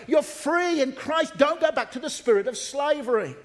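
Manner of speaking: high, emphatic exhortation; full-force preaching. Real, not acted. Preacher urging the congregation to stay free, full-force preaching. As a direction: proclaim it as an urgent plea.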